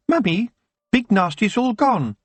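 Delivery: put-on high, bright child's voice; little boy. A narrator's reading. Little boy telling his mother the monster is gone, high and childlike. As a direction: pipe it up like a small boy. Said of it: "a little boy ... said".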